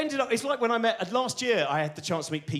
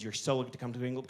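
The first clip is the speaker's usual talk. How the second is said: the same lightly American line, softer and rushed; hushed fast American gush; 6 semitones lower, 8 dB quieter, faster than usual. Retold or imitated. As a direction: rush it in a hushed American accent, like a secret. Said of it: "these American scientists kept saying".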